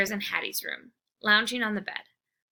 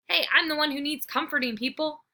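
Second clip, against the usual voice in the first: indignant; 6 semitones higher than usual. Acted. Dog complaining.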